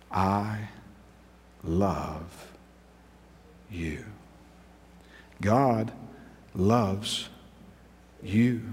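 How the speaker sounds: very soft and low, drawn out; near-whispered I love you; tender hush. Acted. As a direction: breathe each word slowly.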